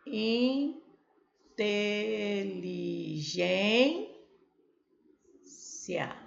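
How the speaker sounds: Inteligência stretched out very slowly, syllable by syllable. Shown as a demonstration. Teacher answering how the syllables split. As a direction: spell out Inteligência in slow separate beats. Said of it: "I A".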